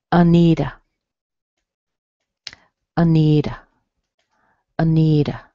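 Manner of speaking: "Anita" as uh-NEE-duh; weak, strong, weak syllables in "Anita"; schwa at both ends. Shown as a demonstration. Teacher giving a good example, schwas in "Anita". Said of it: "you'll hear this little uh sound".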